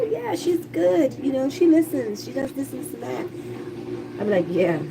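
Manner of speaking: ordinary talk, nothing marked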